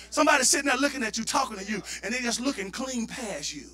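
ordinary talk

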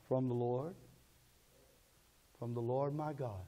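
low, soft reply; quiet acted answer. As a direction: answer low and softly, as the believer. Believer's answer to acted question.

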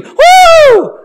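very high, loud whoop; piercing imitated cheer; crowd scream. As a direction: whoop like an excited sports fan. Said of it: "jump up and scream".

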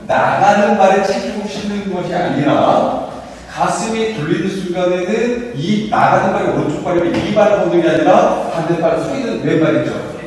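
usual speaking voice

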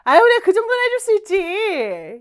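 rising into loud, high protest; effusive acted modesty; friendly brush-off. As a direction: wave away thanks with a big "aw, come on". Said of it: "약간 이런 느낌이에요".

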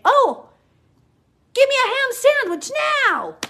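high, loud mocking voice for Peter. Preacher mocking Peter's imagined reply, in a high, loud voice. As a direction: sneer it like a greedy Peter.

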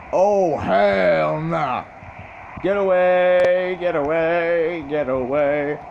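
loud, high, drawn-out cries; alarmed yelling. Real, not acted. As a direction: stretch each word in a panicked holler. Tornado coming at the player.

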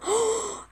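sharp, high gasp; startled gasp. Real, not acted. Player spots someone's item mid-sentence, startled high gasp. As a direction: gasp in sudden surprise.

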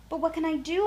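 a fretting mother asking for help; a mother hare at a loss, pleading; worried Mother Hare. A narrator's reading. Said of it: "asked Mother Hare".

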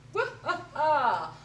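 loud gloating laugh then slow line; cackle, then savour the words. Acted. Alan the storyteller thrills the animals, laughing. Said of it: "laughed Alan".

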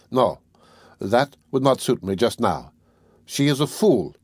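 ordinary delivery

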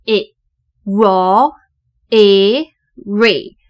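slow, separated E, r, E, re; sounding out. Shown as a demonstration. Teacher showing the first syllable, sounding out re. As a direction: blend r and E into re, piece by piece. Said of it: "第一个音节的E是念成E".

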